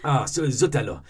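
put-on hammy French accent, lighter; a breezy fake French exclamation. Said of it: "an outrageous, uh, French accent".

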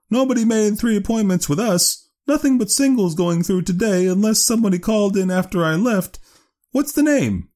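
very high woman's character voice; pitched right up, businesslike clerk; Leora Duncan. Acted. Clerk checking appointments for volunteers, high businesslike voice.

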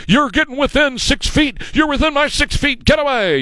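hammed-up Karen telling people off; mock Karen. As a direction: shoo them off like a panicked Karen. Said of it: "Karens screaming at people".

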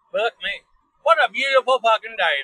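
usual speaking voice

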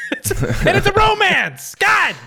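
high laughing shout. Real, not acted. Laughing loudly at joke.

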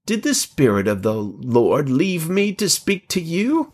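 indignant question climbing in pitch; demanding. A narrator's reading. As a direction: demand an answer, pitch rising high. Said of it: "and demanded".

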